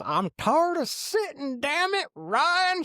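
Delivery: high, slow New York grandma voice; whiny New York accent, drawn out. Retold or imitated. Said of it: "she's got the thick New York accent".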